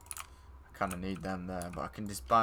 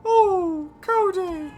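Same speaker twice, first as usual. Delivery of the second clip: silly high voice; 6 dB louder than usual. Pretend. Gamer teasing friend about playing with James, high mocking coo. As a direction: coo it like a tease winding up a mate.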